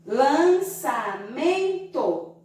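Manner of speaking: "Lançamento" slow, each syllable separate with its own pitch swing; slowly sounded-out "Lançamento". Shown as a demonstration. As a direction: chop "Lançamento" into lan, ça, men, to, drawing each out. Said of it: "é uma sílaba nasal".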